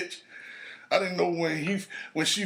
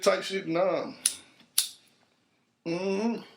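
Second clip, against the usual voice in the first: usual speaking voice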